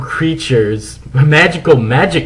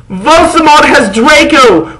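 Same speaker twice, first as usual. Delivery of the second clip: high, slow loud alarm; urgent shout; 13 semitones higher, 5 dB louder than usual. Acted. Goths shouting the news at Dumbledore. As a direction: blurt the news. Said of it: "we shouted".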